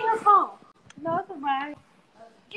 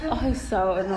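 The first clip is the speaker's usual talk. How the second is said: laughing; 9 semitones lower, 4 dB quieter than usual. Real, not acted. Laughing at toddler.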